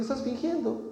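gently expose the pretending; the Lord telling believers, softly accusing. The Lord speaking to us.

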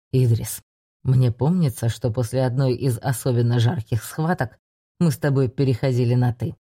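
put-on low, amused old mentor; older man voice. A narrator's reading. Older mage Reinard voiced low.

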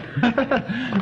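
short, high chuckle; high giggle. Acted. Son amused by his mother, high giggle.

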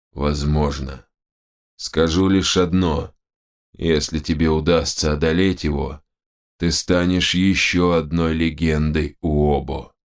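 slow, slightly low shaman pronouncement; solemn shaman. Acted. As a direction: foretell it gravely and unhurriedly. Shaman answers the hero. (торжественный, неторопливый голос шамана)

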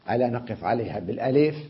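usual speaking voice

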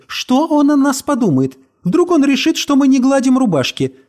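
high, fussy insistent plea; insisting. A narrator's reading. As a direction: press him, fussing, pitched high. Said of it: "настаивала она".

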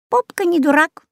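parrot voice. Acted. Parrot Жако answers.

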